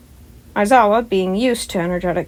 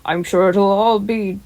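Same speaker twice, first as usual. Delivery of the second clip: big booming hero voice, pitched up and loud; All Might; 3 semitones higher, 6 dB louder than usual. Acted. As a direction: play the cheerful giant hero coaxing a scared kid. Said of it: "All Might said".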